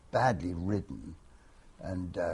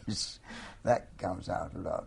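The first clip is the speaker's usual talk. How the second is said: ordinary delivery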